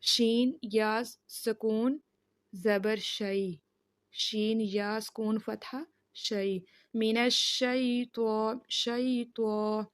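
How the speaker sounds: شین یا سکون spelled letter by letter, slowly; slow spelling out. Shown as a demonstration. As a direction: build شیطان up sound by sound. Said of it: "مجہول نہیں پڑھنا". (حروف جوڑ کر آہستہ آہستہ)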